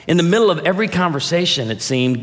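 ordinary talk, nothing marked

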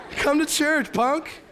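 high, loud comic challenge; mock macho taunt. Driver invites tailgater to church, mock macho taunt. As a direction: holler it like a hammed-up tough guy.

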